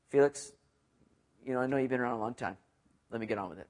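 quick, soft, offhand paraphrase; tossed off, get on with it; blunt paraphrase. Retold or imitated. Preacher paraphrases Paul to Felix, quick and offhand. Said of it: "He just simply says".

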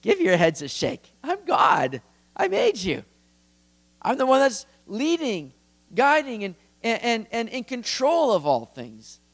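lightly acted exasperation, rising high; God, exasperated. God to rebellious people, exasperated and insistent. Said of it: "God is laughing, like".

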